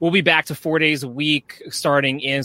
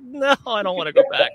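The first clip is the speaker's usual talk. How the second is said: whiny plea; 10 semitones higher than usual. Retold or imitated. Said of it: "I was like".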